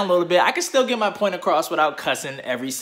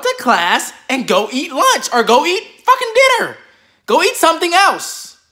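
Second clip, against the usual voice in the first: higher and louder, fed-up complaining; exasperated rant; 10 semitones higher, 4 dB louder than usual. Real, not acted. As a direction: vent about teachers who keep talking. Ranting against teachers after bell.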